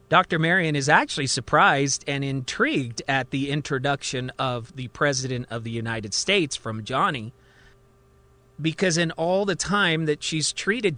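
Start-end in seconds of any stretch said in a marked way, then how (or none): none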